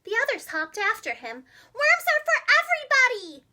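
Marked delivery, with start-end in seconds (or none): 1.7-3.5 high, loud outcry; crying out. Acted. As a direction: protest loudly after the worm thief. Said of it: "they cried".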